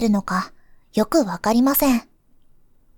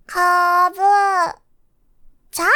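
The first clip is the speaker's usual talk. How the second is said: put-on small child's voice; 5 semitones higher than usual. Child repeating new word.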